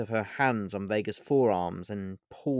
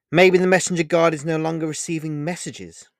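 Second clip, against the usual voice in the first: light joking quip, a touch louder; flippant; 7 semitones higher, 8 dB louder than usual. Acted. Vega jokes to Olivia to lighten mood, flippant. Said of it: "replied Vega flippantly".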